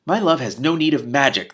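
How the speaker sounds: indignant. A narrator's reading. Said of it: "the princess broke in indignantly".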